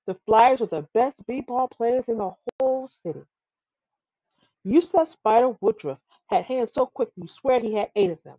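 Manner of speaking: usual speaking voice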